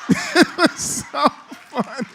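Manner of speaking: wheezing with laughter, high; can barely get the words out for laughing; breathless laughter. Real, not acted. Retelling the towel delivery to the congregation, helpless laughter.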